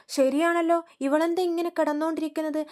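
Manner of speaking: mother-in-law finding the daughter-in-law asleep, loud and high; indignant exclaim. Retold or imitated. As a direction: burst out in indignation. Mother-in-law sees her sleeping.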